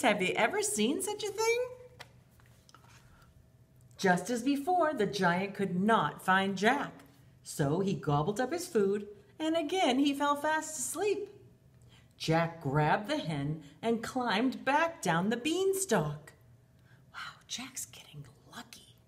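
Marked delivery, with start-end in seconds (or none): 0.0-1.6 amazed. Acted. Asks children in wonder. 17.1-19.0 put-on hushed aside to the kids; whisper. Teacher whispering to the children about Jack's luck.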